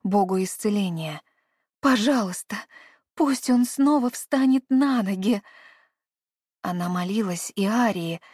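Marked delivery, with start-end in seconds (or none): 1.8-5.4 pleading. A narrator's reading. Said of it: "взмолилась она".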